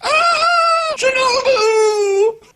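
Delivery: very high, loud drawn-out shriek of her name; panicked cry. Retold or imitated. Imitating Shinobu freaking out.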